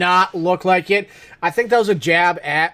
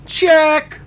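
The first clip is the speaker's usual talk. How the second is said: high, loud, squeaky call for the check; mock customer; 11 semitones higher, 5 dB louder than usual. Friends quoting the diner scene in a high, loud voice. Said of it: "quoting the movie line".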